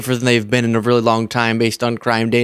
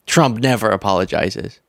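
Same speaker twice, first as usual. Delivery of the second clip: normal talking voice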